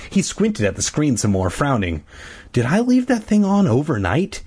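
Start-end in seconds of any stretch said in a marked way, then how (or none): none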